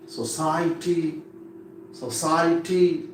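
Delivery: "society" slowly, with weight on CI; stress on CI. Shown as a demonstration. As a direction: lean on the second syllable, so-CI-ety, in "society". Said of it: "Here stress mark is there".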